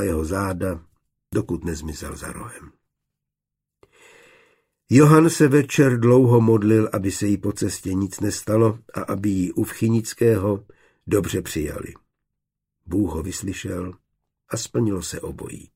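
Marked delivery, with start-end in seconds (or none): none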